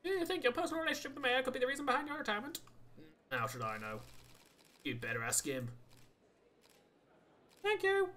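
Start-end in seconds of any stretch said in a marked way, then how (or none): 0.0-2.6 reporter voice. Acted. Reporter questioning at press conference. 7.6-8.1 high, loud polite thanks; bright polite reporter thank you. Retold or imitated. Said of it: "a very polite, singular reporter".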